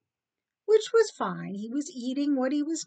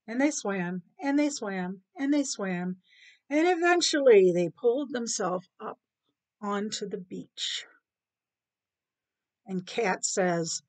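ordinary delivery, nothing marked

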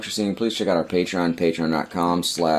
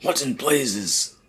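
put-on slow, gruff old man; a hint of a startled old man; 6 semitones higher, much slower than usual. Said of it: "I was doing all the other voices".

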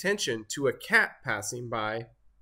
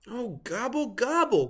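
groaning turkey; 9 semitones higher than usual. A narrator's reading. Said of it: "groaned Turkey".

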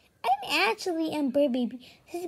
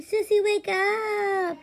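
wake-up call; 5 semitones higher, 8 dB louder, much slower than usual. Pretend. Waking sister in pretend play.

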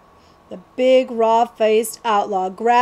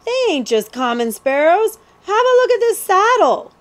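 higher, louder, rising excitement; excited outlaw; 10 semitones higher, 6 dB louder than usual. Acted. Cutwater calls Billy over to see the saddle, excited. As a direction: call him over, thrilled by the find.

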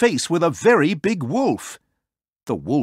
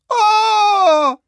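piercingly high, loud pig squeal; very high cartoon scream of terror; terrified squeal; 10 dB louder than usual. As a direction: shriek in fright at the top of your range. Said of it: "another terrified squeal from Mister Elegant".

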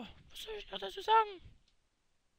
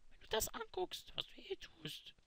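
whispered aside; 11 dB quieter than usual. Acted. Aside to Gomme.

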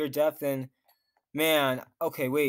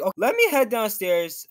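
usual speaking voice

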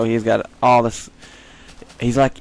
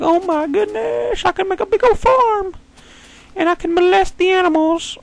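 hammed-up excitement. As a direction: imitate yourself thrilled in a silly high voice. Acts out excited past reaction.

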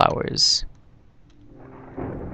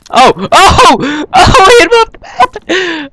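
excited; 8 dB louder, slower than usual. Real, not acted. Knocks enemy off map.

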